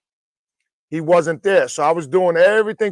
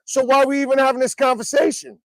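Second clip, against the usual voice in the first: high, quick rhetorical question; mock exasperation; 8 semitones higher, faster than usual. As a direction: rattle off a baffled comeback up high. Acted banter, topping the comeback.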